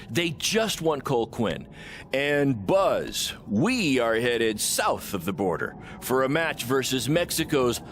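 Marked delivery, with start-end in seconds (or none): none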